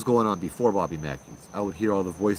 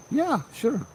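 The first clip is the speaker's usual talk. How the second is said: much higher, breezy and agreeable; mock-casual high "yeah, sure"; high cheerful reply; 13 semitones higher than usual. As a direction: pitch way up, play it casual. Narrator quoting his own reply.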